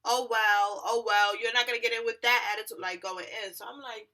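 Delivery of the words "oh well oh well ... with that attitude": loud, dismissive mock announcement; clerk on the mic. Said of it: "she's, like, on the mic".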